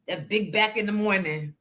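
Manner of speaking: ordinary delivery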